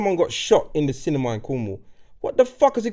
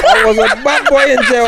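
laughing mock tough talk; 5 semitones higher, 6 dB louder than usual. Mocking a fake gangster brag.

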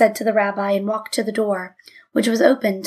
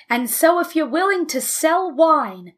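raised, higher angry voice; yelling; 5 semitones higher than usual. A narrator's reading. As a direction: yell it at the rabbi. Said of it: "yelled Abe".

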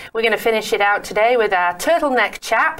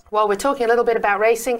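ordinary talk, nothing marked

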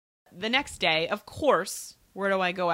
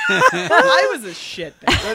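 slow, high, through laughter; laughing; 10 semitones higher, 12 dB louder, slower than usual. Real, not acted. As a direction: admit it while laughing. Laughing admission.

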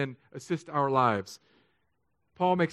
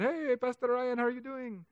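badly faked Cuban accent greeting; terrible Cuban accent; 10 semitones higher than usual. Church member greets the pastor, badly imitated Cuban accent. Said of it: "that's a terrible Spanish, uh, or Cuban accent".

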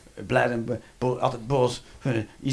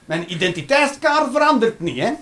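usual speaking voice

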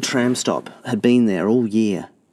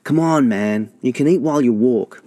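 higher, louder hurry-up urging; 5 semitones higher, 4 dB louder than usual. A narrator's reading. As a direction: push your brother to hurry. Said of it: "Castor urged".

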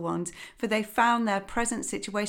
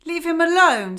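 higher, louder sharp defence; loud protective mother; protective call; 10 semitones higher, 9 dB louder than usual. Acted. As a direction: shield the duckling.

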